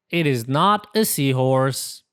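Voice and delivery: usual speaking voice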